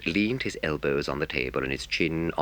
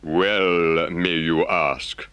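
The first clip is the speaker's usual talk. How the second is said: reproachfully; 3 semitones higher, 6 dB louder, much slower than usual. Acted. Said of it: "said the Rat reproachfully".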